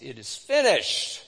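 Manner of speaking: crying out loud, with triumph; the dying man's last loud cry. A narrator's reading. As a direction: call it out with full voice. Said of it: "cried out in a loud voice".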